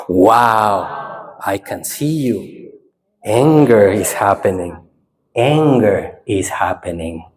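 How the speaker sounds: low, unhurried noting with pauses; calm observing. Shown as a demonstration. Teacher showing the second experiment, calm observing. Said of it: "Next experiment".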